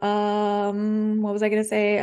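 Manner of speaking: long humming um while stalling; acted hesitation. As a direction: mimic someone searching for their words. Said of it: "So you're like".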